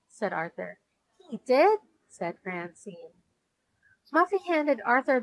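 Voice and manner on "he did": surprised. A narrator's reading. Surprised disbelief at winner.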